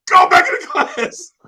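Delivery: high, loud barked command; teacher order. Retold or imitated. Teacher sends crying boy back to class, loud order. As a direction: order the kid back inside.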